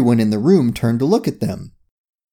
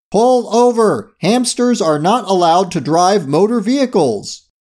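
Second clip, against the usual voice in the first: raised, higher official announcement; megaphone call; 7 semitones higher than usual. A narrator's reading. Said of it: "called out through a megaphone".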